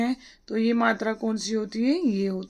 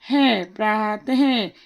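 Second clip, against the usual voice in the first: very slow letter-by-letter: पै रे आ तै है; sounding out letters; much slower than usual. Shown as a demonstration. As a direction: spell it out slowly, each sound apart. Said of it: "आगे देखिए".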